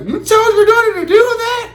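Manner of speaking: high, disbelieving lady; teacher asking dad in shock, high and disbelieving; incredulous teacher. Retold or imitated. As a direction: gasp it out in disbelief.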